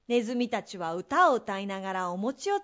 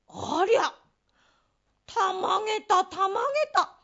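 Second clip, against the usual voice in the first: surprised; 8 semitones higher, 4 dB louder than usual. Acted. Finds gold coins.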